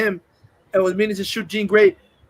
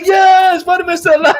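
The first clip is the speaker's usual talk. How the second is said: much higher, loud joyful shout; cheering crowd; 10 semitones higher, 8 dB louder than usual. Retold or imitated. Crowd celebrating Spider-Man's survival, high cheering shout. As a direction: cheer like a relieved fan. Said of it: "and they're like".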